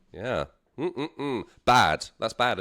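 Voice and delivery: usual speaking voice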